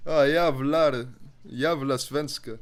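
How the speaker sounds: slow Swedish swearing; drawn-out mock Swede; Swedish accent. Shown as a demonstration. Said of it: "I can make a better Swedish accent".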